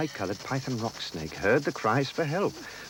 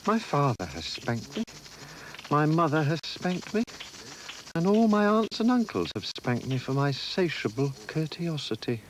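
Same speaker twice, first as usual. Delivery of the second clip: put-on child voice; 7 semitones higher than usual. Elephant's child voice.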